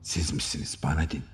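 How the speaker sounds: ordinary delivery, nothing marked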